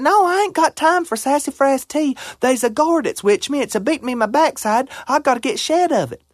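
put-on high, urgent old granny voice; granny begging the groundhog for help, high and frantic. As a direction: plead for help in a panic.

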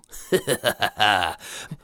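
low gloating cackle; a villain's satisfied laugh; low evil chuckle; goat's evil chuckle. Acted. Goat gloats.

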